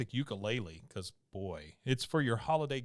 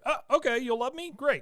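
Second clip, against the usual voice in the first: much higher, louder squeaky delight; mock delighted bear; 13 semitones higher, 7 dB louder than usual. Cohost voicing the marshmallow bear, high hammed-up delight. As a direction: gush like a bear suddenly won over. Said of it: "he's like".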